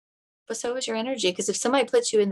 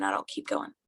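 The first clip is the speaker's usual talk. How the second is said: whisper; 13 semitones lower, 8 dB quieter than usual. Retold or imitated. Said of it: "He's like".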